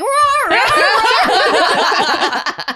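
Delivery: high loud mock dog wail. Imitating a wailing dog from a video, high and loud. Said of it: "a dog, like, wailing".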